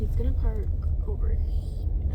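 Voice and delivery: hushed whisper, slowing down. Real, not acted. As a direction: whisper so he won't hear. Whispering as coworker arrives.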